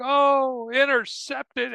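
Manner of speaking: high, slow, hushed-in-wonder call; awed broadcaster. Retold or imitated. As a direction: let the word hang in amazement. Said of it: "It's just like".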